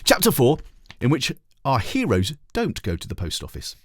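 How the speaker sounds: ordinary delivery, nothing marked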